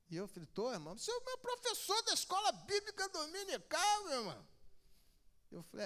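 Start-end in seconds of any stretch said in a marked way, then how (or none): 0.5-4.5 very high woman's voice; the sister praising her teacher in a very high voice; imitating the woman. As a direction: squeak it out as the eager sister.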